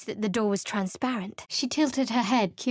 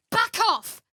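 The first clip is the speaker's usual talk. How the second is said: angry shout; 12 semitones higher than usual. Acted. Character threatens an animal.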